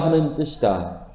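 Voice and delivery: normal talking voice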